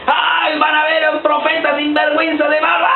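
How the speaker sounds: high mocking outcry; mock shout. Preacher voicing a jeering crowd, mock shout. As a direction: jeer like a scandalised crowd.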